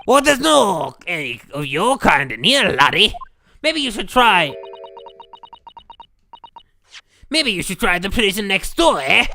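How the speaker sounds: put-on loud gruff Scottish accent. Governor dismissing the visitors, loud mocking Scottish accent. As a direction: scoff it in a loud Scottish brogue.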